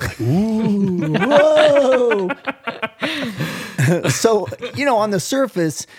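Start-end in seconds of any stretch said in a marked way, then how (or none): 0.0-2.3 high, louder, laughing spooky whoa; mock spooky ooh. Mock spooky sound reaction. 2.4-4.0 high, rolling laugh. Real, not acted. Laughs after spooky sound.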